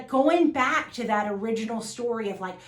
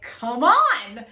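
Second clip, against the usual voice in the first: high, loud, gushing yes; delighted host voice, high and loud; gracious eager welcome; 7 semitones higher, 6 dB louder, slower than usual. Retold or imitated. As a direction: welcome her in with open arms. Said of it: "they were just graciously like".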